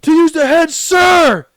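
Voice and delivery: high, loud recruit bark; barked sir yes sir. Retold or imitated. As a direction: yell the request at attention. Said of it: "And you're like".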